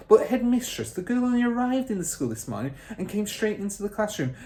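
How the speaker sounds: high, fast pleading teacher; teacher hurrying to defend the girl; urgent protest. Acted. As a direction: rush to explain. Miss Honey voiced.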